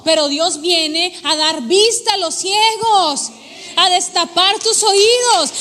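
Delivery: loud, fervent preaching climax; preaching at full force. Acted. Preacher lifting the congregation, loud and fervent. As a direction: preach it out with rising fire.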